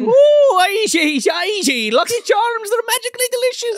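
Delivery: Lucky Charms leprechaun. Pretend. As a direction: play the cartoon leprechaun from the ad. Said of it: "trying to steal his Lucky Charms".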